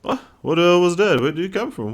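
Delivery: loud, high, startled questioning; baffled outburst. Acted. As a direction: react in alarm to a bizarre vision. Startled after the pirate vision.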